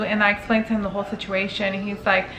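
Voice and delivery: ordinary talk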